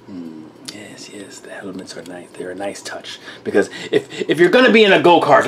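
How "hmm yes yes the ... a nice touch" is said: hushed whisper. Real, not acted. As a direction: breathe it out softly. Whispered aside.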